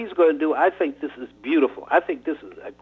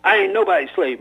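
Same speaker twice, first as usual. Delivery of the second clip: higher, louder defiant cry; shrieked protest; 6 semitones higher, 4 dB louder than usual. Acted. Young robber protesting to the old man, shrieking. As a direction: fling it back at him. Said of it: "Mike shrieked".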